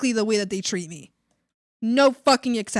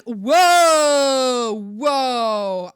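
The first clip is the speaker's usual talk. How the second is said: long falling whoas, first one loud; retold drawn-out whoa; 7 dB louder than usual. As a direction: draw it out to halt her rant. Retold stunned objection to coworker.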